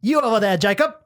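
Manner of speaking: put-on botched French accent. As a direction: play along with a badly faked French accent. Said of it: "Is that French?".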